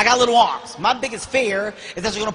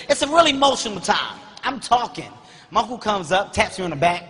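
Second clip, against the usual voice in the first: ordinary talk, nothing marked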